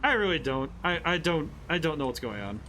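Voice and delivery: ordinary talk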